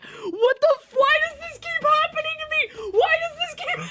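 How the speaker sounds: very high, squealed mock despair; hammed-up high-pitched whine; retold disbelief. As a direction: wail it in comic disbelief.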